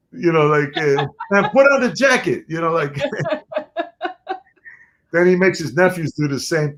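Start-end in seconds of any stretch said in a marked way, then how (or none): 1.3-2.4 Caco's voice. Retold or imitated. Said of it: "'Cause he talked like this".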